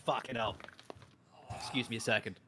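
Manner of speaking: ordinary delivery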